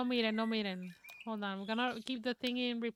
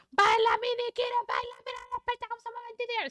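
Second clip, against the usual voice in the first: much higher, loud, rapid cheer; cheering squeal; 11 semitones higher, 5 dB louder, much faster than usual. Real, not acted. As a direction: hype up the dancer. Cheering avatar dancing.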